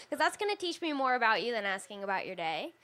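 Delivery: usual speaking voice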